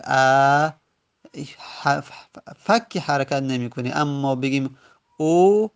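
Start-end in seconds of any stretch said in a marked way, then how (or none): 0.0-0.7 "ا" as a held "aa", jaw unmoved; fatha vowel. Shown as a demonstration. Teacher showing the lightest vowel, "ا" held as a long a. 5.2-5.7 "او" as a held, louder, rising "oo"; damma vowel. Shown as a demonstration. Teacher showing jaw movement, "او" held loud as a long u.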